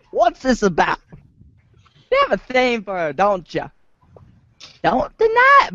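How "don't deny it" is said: excited tease. Acted. Teasing accusation of a crush.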